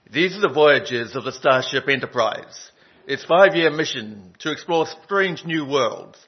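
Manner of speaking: loud, declaimed starship captain's narration; hammed-up TV opening voiceover; William Shatner. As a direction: proclaim it boldly, with dramatic pauses. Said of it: "I'll do my best William Shatner voice".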